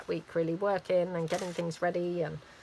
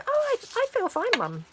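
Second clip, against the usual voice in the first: very high, dismissive little voice; imitated daughter; 3 dB louder than usual. Daughter reassuring mum after no sleep, imitated. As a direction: mimic a daughter brushing off mum's concern. Daughter's quoted reply, retold.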